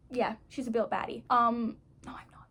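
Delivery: ordinary talk, nothing marked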